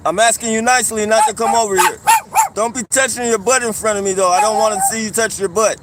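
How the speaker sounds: fast, loud, firm warning; loud call-out. Real, not acted. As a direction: shout it across the street. Telling man to leave.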